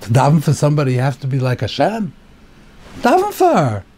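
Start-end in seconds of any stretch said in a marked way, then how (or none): 3.0-3.9 very high, exasperated appeal; high-pitched protest; exasperated urging. Real, not acted. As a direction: urge it as if obvious, pitch jumping up. Teacher argues against Yaakov's excuse.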